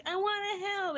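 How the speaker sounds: high, pleading kid imitation; mock tearful child. Daughter crying as daddy takes her, high imitated plea. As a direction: imitate a little girl about to cry. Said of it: "And she cries".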